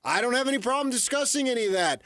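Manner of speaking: normal talking voice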